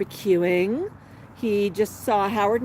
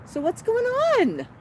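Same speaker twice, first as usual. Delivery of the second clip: high pet talk; 11 semitones higher, slower than usual. Real, not acted. Asking the pet.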